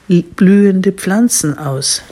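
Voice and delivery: plain reading voice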